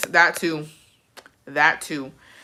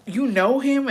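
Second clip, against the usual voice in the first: higher-pitched incredulous question; surprised classmates; 7 semitones higher, slower than usual. Retold or imitated. Classmates asking about the crying boy, surprised. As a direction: imitate everyone's startled question.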